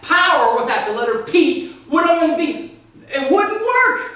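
high loud climax, slowing at the end; mock passion. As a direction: build to a squeaky overwrought peak. Orator climaxes his mock speech.

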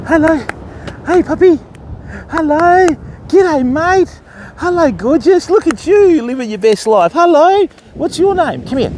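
high, delighted puppy-greeting voice; greeting a dog. Real, not acted. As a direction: gush in a high pitch to a passing puppy.

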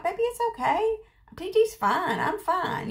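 high, gentle reassurance; reassuring. Retold or imitated. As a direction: soothe a friend who keeps apologizing. Reassuring her apologizing friend.

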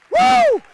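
very high shouted woo; a joyful cry to the rafters; ecstatic whoop. Real, not acted. Preacher whoops.

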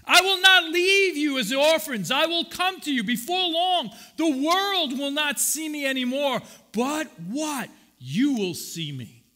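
loud, high preaching of scripture. Acted. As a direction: proclaim the verse with force. Reading Jesus' words aloud.

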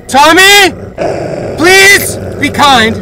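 high, loud, slow pleading; frightened pleading. Real, not acted. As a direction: beg it to be kind. Pleading with creature.

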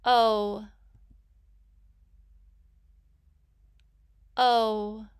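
"O" alone as a loud, held oh; letter sound demo. Shown as a demonstration. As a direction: sound out "O" firmly and clearly. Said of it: "I will say the sound".

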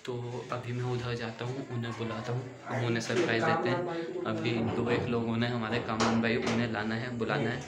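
speaking softly so as not to be overheard; quiet, secretive aside to camera; hushed voice. Real, not acted. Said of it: "मैं इसलिए धीरे बोल रहा हूँ".

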